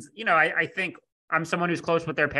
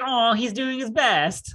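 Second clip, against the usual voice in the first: fond; 6 semitones higher, slower than usual. Retold or imitated. Fond comment on Joel.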